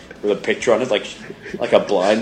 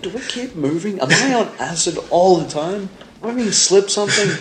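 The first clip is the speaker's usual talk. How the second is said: much higher, mock bewildered questions; 9 semitones higher than usual. Host voicing his confused self, high and bewildered. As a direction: pitch up like you're losing your mind. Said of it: "I'm like".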